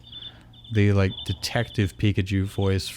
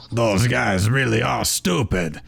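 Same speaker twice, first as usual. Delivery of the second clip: luchador bird voice. Acted. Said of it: "Does that sound like a luchador bird".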